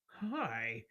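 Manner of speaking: lightly voiced eager scholar, slow and careful; polite scholarly lizard folk. Sarav greets the land people, slow and polite. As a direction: greet the strangers slowly, keen on their culture.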